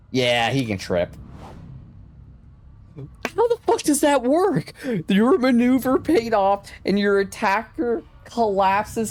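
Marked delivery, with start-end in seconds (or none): 3.0-9.1 high-pitched disbelief; incredulous outburst. Real, not acted. Player amazed the absurd trip worked, incredulous. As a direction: burst out in amazement, voice jumping way up.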